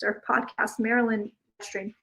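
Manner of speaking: normal talking voice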